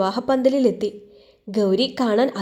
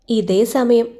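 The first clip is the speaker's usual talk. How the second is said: ordinary delivery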